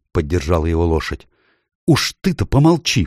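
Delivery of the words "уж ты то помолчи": high, sharp rebuke; cross, high-pitched telling-off; snapping at someone. A narrator's reading. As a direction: cut her off crossly, pitch up. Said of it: "прикрикнула на неё девочка".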